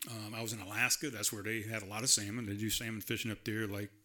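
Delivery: ordinary talk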